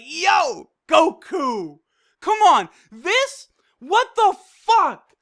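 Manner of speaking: loud, high fan outburst; hyped disbelief. Retold or imitated. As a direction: exclaim it, astounded and thrilled. Re-enacts amazed reaction to Goku.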